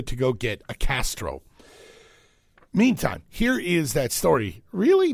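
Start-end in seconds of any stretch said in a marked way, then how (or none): 4.7-5.1 high squeaky incredulous really; voice cracking. Shown as a demonstration. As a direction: break high in disbelief. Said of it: "That I said that".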